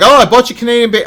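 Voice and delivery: high, loud, fast sing-song mock; prissy mocking impression; mocking Canadians. Host mocking Canadians selling ham as bacon, high sing-song. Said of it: "they're like".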